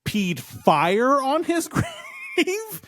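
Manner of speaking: slow, rising disbelief, each word dragged out; drawn-out outraged disbelief building to a high squeak; can't believe what he's describing; incredulous. Real, not acted. Incredulous rant about dog pee.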